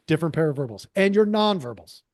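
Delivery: ordinary talk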